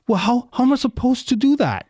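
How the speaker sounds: high, baffled mock plea; exasperated question. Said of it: "I was like".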